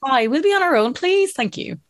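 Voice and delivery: mock-polite request. Scots asking to be on their own, mock-polite. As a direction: put on a chirpy, polite ask.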